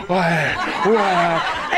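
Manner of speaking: low drawn-out puzzled "why, why I". Retold or imitated. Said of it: "But now they have to go".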